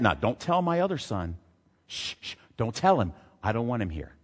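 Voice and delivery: a father shushing so the other son won't hear; mock hushed dad. Imagined dad hushing about party.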